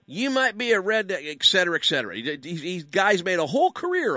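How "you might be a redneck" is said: Jeff Foxworthy. Retold or imitated. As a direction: toss out the catchphrase like a Southern stand-up. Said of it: "familiar with Jeff Foxworthy's humor".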